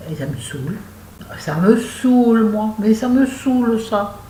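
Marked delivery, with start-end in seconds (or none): 1.4-4.2 high, loud, drawn-out mock complaint; hammed-up exasperation. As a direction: stretch SAOULE out, high and loud, theatrically fed up. Hams up her catchphrase of annoyance.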